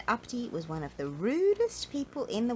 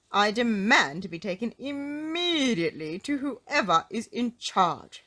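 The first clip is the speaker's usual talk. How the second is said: loud, indignant, higher-pitched demand; angry; 4 semitones higher, 7 dB louder than usual. A narrator's reading. Mister Uppity demanding whoever is in charge, angrily. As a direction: demand it angrily, drawing out the final words. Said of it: "said Mister Uppity angrily".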